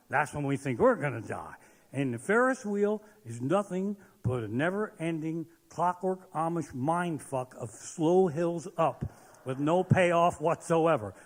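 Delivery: usual speaking voice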